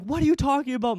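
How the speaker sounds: high-pitched retold bewilderment; baffled protest. Son answering his mom's fighting accusation, baffled. Bewildered reply to freaking-out mom.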